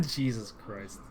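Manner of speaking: normal talking voice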